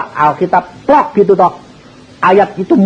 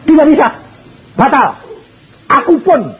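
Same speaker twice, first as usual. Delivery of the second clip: firm insistence; 10 semitones higher than usual. Acted. Young man insists firmly.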